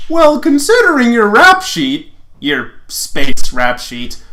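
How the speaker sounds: high cocky jab, then slow on space rap sheet; Lance voice. Acted. Lance mocking Lotor's record, high, then slowed. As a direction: tease him high and loud, then drag it out.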